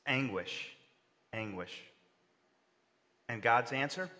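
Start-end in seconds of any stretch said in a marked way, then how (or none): 1.3-1.8 quiet echo. Shown as a demonstration. Preacher repeats word for effect.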